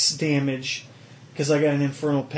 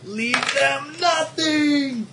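dramatic battle cry; 9 semitones higher, much slower than usual. Acted.

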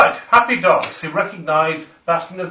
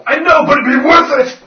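much higher, louder cheerful retort; gleeful defiance; 9 semitones higher, 11 dB louder than usual. Real, not acted. Player insisting the self-damaging fireball is worth it. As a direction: reckless glee.

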